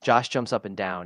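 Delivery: usual speaking voice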